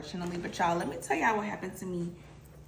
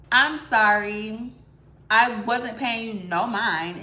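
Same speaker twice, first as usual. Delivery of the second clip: usual speaking voice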